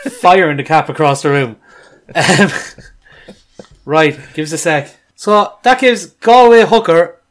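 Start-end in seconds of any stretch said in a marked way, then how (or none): 5.2-7.2 put-on a shaky first try at a Galway accent, pitched higher and louder; half-hearted Galway accent announcing the score; Galway accent attempt. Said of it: "I'll give it a go".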